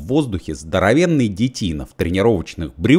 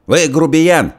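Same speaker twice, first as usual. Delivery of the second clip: loud, much higher shout; 10 semitones higher, 5 dB louder, slower than usual. A narrator's reading. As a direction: shriek the insult. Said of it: "закричал толстый". (громкий крик, высоко)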